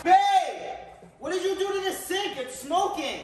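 alarmed call. Acted. Calling out, alarmed.

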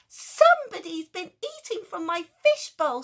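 high, loud indignant bellow; angry daddy shout. Acted. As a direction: roar it like an outraged dad. Daddy person shouting in dream.

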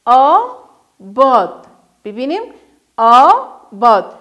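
کشیده و آهسته; drawn out slowly. Shown as a demonstration. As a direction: stretch the word slowly. Teacher modelling syllables.

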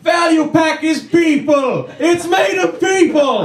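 high, slow, frantic yell; shouting. Retold or imitated. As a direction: scream it like a doomsday warning. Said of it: "see someone shouting".

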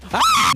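short high shrieking scream; scream of fright. Real, not acted. Gamer jump-scared mid-match, screaming. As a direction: shriek as it jumps out.

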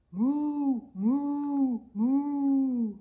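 loud drawn-out mooing; cow moo. Acted. Reader voicing the cow for children, loud moo. Animal sound in kids' book.

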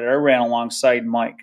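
usual speaking voice

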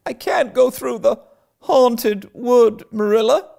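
put-on high, frightened girlish plea; desperate cry. Anne begging Marilla to spare her the wood, desperate. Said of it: "cried Anne desperately".

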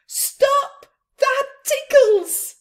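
high, loud giggling protest; laughing protest; tickled shout. Acted. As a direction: squeal it as if tickled.

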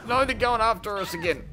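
high, loud cry of alarm. Real, not acted. Player seeing enemies go after the party, alarmed. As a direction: cry out in dismay, pitched high.

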